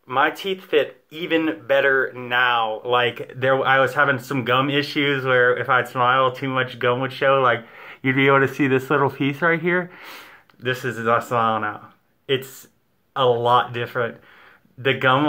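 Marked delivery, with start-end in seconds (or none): 5.2-9.9 lips pulled back grimacing; pointing inside mouth while talking; finger in mouth. Real, not acted. Seen 0: Finger pressed to upper teeth and gum, lips pulled back, while speaking.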